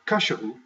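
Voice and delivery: CACHORRO with the first CA stressed; wrong-syllable stress. Shown as a demonstration. Teacher showing the other wrong way, first-syllable stress. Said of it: "You don't say".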